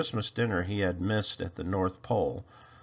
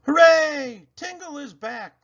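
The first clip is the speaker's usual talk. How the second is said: cheering shout; 9 dB louder than usual. A narrator's reading. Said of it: "they shouted".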